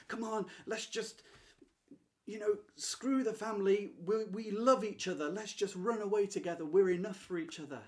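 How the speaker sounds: high, loud, urgent modern-film lover; energetic, emotional. Acted. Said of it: "Now that's energy and emotion".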